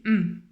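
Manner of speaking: M sounded as a hummed "mmm"; letter sound demo. Shown as a demonstration. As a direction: say M as its bare sound, not the letter name. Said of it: "Donc là j'écris M".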